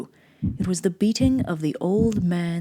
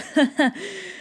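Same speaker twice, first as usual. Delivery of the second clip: high mad giggle; 11 semitones higher than usual. Acted. Madman laughs after confession.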